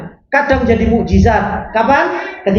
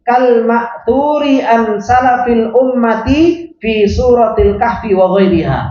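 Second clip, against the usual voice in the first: slow reading; slower than usual. Shown as a demonstration. Teacher reading Arabic text aloud.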